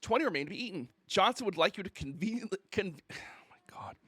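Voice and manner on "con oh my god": whispered sigh of disbelief; exasperated whisper. Real, not acted. Streamer whispers in exasperation at the insulting text. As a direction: breathe it out, done with this. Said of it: "I gotta slow down here".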